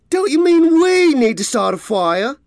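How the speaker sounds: we stretched high and loud, mocking; mocking stress on we. A narrator's reading. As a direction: sneer out the word we. Bully mocks him.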